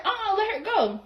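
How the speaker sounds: high-voiced "no, let go" retort; high protest. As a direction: pitch it up, waving them off. Acted protest, voice still up.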